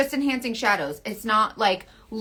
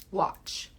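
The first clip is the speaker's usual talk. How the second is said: ordinary delivery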